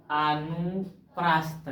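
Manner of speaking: अनुप्रस्थ drawn out slowly, syllable by syllable; easy, unhurried. Shown as a demonstration. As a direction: say अनुप्रस्थ at an easy, slow pace. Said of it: "कितना आराम से बोला".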